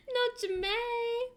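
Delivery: very high, drawn-out character voice; Jamae. Acted. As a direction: stretch it out high in mock dismay. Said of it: "It doesn't help that I love J".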